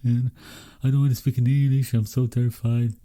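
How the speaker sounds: fast, nervous self-talk; hurried worried muttering to yourself; anxious inner thought. Retold or imitated. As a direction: rush it like a scared inner voice. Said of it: "And I thought to myself".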